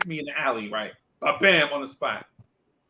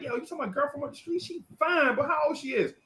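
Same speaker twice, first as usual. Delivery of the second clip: high, fast, eager uncle; uncle's high excited voice; 9 semitones higher, 5 dB quieter than usual. Retold or imitated. Uncle quizzing his nephew, high and eager. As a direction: perk up like the uncle hearing about a fine girl. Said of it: "he was like".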